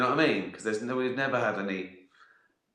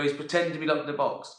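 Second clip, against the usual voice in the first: fast impatient repeat; 4 semitones higher, much faster than usual. Pretend. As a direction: rush it out, exasperated. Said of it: "I go".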